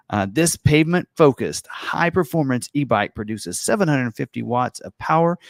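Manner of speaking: lightly hyped ad announcer read. Pretend. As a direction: read it like a product trailer announcer. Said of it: "like, announcer voice".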